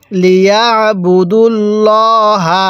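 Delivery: slow recitation of লিয়াবুদুল্লাহা, heavy L held long; heavy lam. Shown as a demonstration. Teacher corrects the lam of Allah, heavy drawn-out recitation. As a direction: round ল্লা in লিয়াবুদুল্লাহা to a thick "law". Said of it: "অর্থাৎ মোটা করে পড়ব".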